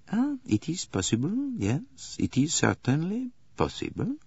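high, soft, doubtful musing; pondering. Acted. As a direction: ponder it aloud, pitched well up. Poirot ponders doubtfully in story.

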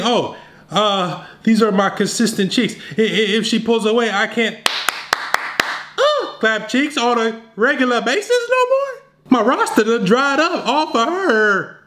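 high, fretful mock voice; panicking beta dude. Guy fretting over losing his girlfriend, high mock whine. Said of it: "they think".

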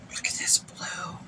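soft, voiceless whisper of amazement; awed whisper. Real, not acted. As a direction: whisper it, awestruck by the colour. Awed at a swatched shade.

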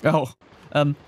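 usual speaking voice

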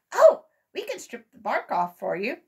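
keen to help out; eager offer. A narrator's reading. Squirrel Brothers offering to strip the bark, eager. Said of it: "said the Squirrel Brothers".

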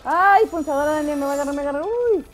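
loud, high cry for help; panicked cry. Real, not acted. Player warning Daniel it will grab her, panicked. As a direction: yelp a warning as something grabs at you.

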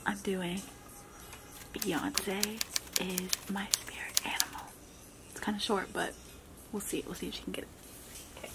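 very soft, near-whispered secret; hushed aside. Real, not acted. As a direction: tell the viewers under your breath. Secret aside so guesser cannot hear.

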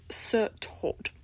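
a mocking little sneer on Sir Todd; derisive. A narrator's reading. Eumenia mocking Todd's chosen name, derisive. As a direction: say the title with scorn. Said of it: "she said derisively".

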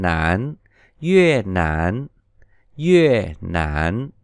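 nản dipped low; yue nản low. Shown as a demonstration. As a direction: sink nản then rise. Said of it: "đọc gần như dấu ngã của mình".

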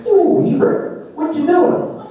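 high, slow puppet voice; Ernie voice. Acted.

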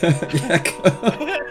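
high breathless giggle; can't stop laughing. Real, not acted. Laughing at his partner's offer, a breathless giggle.

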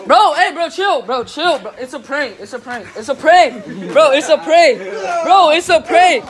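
loud, pleading prank reveal; urgent shouting. Real, not acted. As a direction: shout over him that it's a prank. Revealing prank to angry friend.

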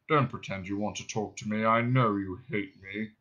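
low, self-pitying drone; depressed robot. Acted. Marvin accuses Ford of hating him, morose drone. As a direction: drone it wearily, without hope.

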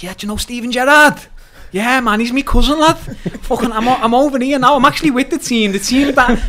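high, loud, fast-talking chancer; excited blagging. Retold or imitated. Bragging to the manager about Gerrard to get in, excited blag. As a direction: hype it up with a laugh breaking through.

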